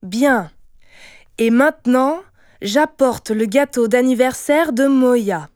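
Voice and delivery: solemn announcement. A narrator's reading. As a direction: proudly announce it to the whole table. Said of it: "se leva de façon solennelle". (annonce solennelle)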